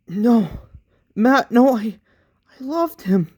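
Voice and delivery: high, loud grief-stricken protest; anguished crying out. Acted. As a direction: cry out over his death. Mourning Matt's death.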